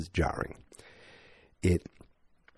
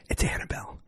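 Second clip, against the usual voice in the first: spooky whisper; faster than usual. Acted. Revealing the girl's real name to viewers, whispered. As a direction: whisper "It's Annabelle" like a secret.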